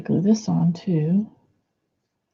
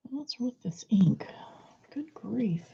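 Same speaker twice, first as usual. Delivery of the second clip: whispering; 4 semitones higher, 11 dB quieter than usual. Real, not acted. Muttering to self over ink.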